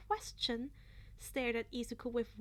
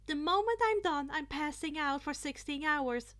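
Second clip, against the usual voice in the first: frazzled inner voice; 4 semitones higher, 6 dB louder than usual. A narrator's reading. Exhausted mindset voiced in narration.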